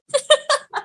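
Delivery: high, short burst of laughter; mocking laugh. Real, not acted. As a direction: laugh at your own jibe. Menertawakan ucapannya sendiri yang mengejek. (tawa mengejek bernada tinggi)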